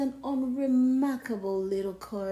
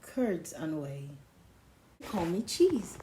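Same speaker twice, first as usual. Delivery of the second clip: ordinary delivery, nothing marked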